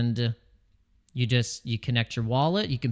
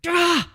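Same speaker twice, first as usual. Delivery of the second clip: short, very high yelp; a sudden pained squeak of annoyance; high-pitched "ah" of irritation; frustrated yelp; 4 dB louder than usual. Retold or imitated. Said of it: "I was like".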